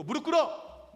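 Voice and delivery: loud, high barked command; imperious shout. Retold or imitated. Abusive customer to a restaurant worker, imperious shouting. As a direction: order them down, in mock fury. Imitates customer ordering staff to kneel.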